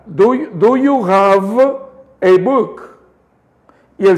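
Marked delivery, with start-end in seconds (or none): 0.1-3.0 slow, careful English question; slow demo. Shown as a demonstration. Teacher modelling the question slowly for students. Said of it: "que vayan repitiendo y repitiendo".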